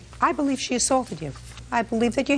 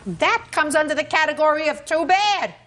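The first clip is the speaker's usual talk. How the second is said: raised pitch, forceful dismissal; emphatic rebuke; 6 semitones higher than usual. Real, not acted. Judge telling a litigant her injury earns no sympathy, emphatic. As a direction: lay down the law, no sympathy.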